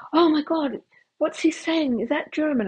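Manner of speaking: high, alarmed imitation of Ian; imitating Ian. Ian on the bus hearing the banging, high and alarmed. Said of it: "He goes".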